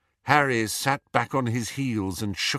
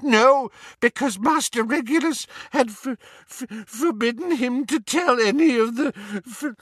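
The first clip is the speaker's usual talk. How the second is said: high elf voice, stammering toward tears; old servant elf confessing, breaking down; grieving house-elf; 13 semitones higher than usual. Acted. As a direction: let the voice crack and stutter as grief rises. Story figure Kreacher.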